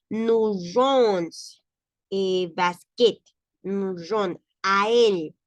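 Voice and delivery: slow French "Nous jouons" with "a él" drawn out; French read aloud. Shown as a demonstration. Teacher reads the French example, stretching it.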